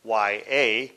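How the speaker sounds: slow, letter by letter; spelled out. Shown as a demonstration. Teacher spelling a Hebrew word for listeners, slowly letter by letter. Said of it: "it's spelled".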